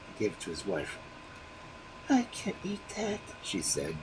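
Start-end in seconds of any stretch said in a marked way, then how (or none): 2.1-3.2 high, soft, turning away in disgust; disgusted refusal. A narrator's reading. Wife refusing the honey, disgusted. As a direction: refuse the food with distaste. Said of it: "turning away in disgust".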